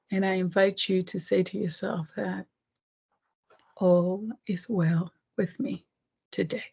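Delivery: guided meditation. Acted. Meditation affirmation and breathing.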